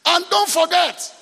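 high, loud, drawn-out reminder; slow shout, each word driven home; emphatic call. Real, not acted. Preacher reminding the congregation, slow loud call.

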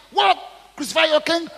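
high loud disbelieving question; incredulous. Acted. Pilate asking the mob in loud disbelief. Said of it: "Pilate asked".

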